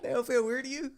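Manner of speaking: high mocking disbelief; incredulous. Echoes quote, mocking disbelief.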